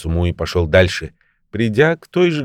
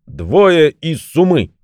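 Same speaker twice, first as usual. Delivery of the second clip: slow, high-pitched magic command; commanding call; 7 semitones higher, 3 dB louder, slower than usual. A narrator's reading. Old man summons two fellows from his bag, commanding call. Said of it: "Крикнул он своей суме".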